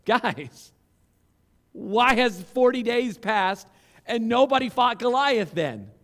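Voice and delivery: pleading in disbelief, rising to a shout; incredulous disbelief. Retold or imitated. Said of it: "questioning these men almost in disbelief".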